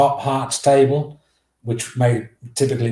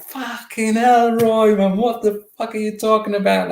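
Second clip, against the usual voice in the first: outraged teammate; 11 semitones higher than usual. Retold or imitated. Teammate on the bus slating Roy, high outraged yell.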